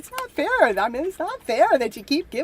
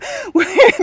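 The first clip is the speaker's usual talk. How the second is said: high, loud giggle; burst of laughter; 7 semitones higher, 8 dB louder than usual. Real, not acted. Host laughing at her own boast, high and loud.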